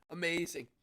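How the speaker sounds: ordinary delivery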